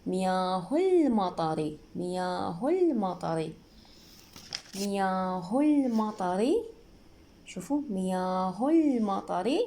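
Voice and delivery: مياه المطر slowly linked as "miyaahu-lll-matari"; silent hamzat wasl. Shown as a demonstration. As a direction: glide from "hu" straight into a held l in مياه المطر. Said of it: "نلفظها لا". (وصل بطيء بدون همزة)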